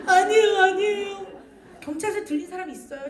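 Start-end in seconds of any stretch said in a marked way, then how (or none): 0.1-1.3 high, loud mock child's protest; pleading child. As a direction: cry out like a threatened little kid. Child pleads against police threat.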